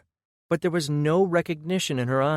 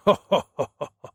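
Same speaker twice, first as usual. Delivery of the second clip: a big rolling laugh from the giant; giant's booming laugh; 2 semitones higher, 5 dB louder than usual. Acted. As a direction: laugh it off heartily, like a huge creature. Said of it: "another fit of laughter".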